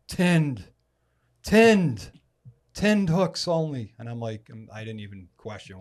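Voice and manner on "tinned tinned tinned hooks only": louder, higher, Tinned punched each time; emphatic insistence. Retold or imitated. Nate pointing at the young man, insisting loudly. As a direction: drill the word Tinned into him.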